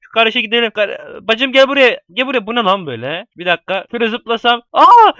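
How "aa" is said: sudden high yelp of fright; screaming while falling. Real, not acted. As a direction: shriek as you nearly drop. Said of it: "aşağı düşüyordum valla".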